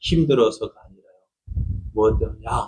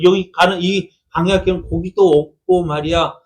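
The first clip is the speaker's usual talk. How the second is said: usual speaking voice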